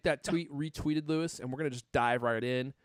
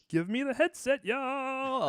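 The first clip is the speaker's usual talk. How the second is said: mock chat voice; 13 semitones higher, much slower than usual. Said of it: "He says".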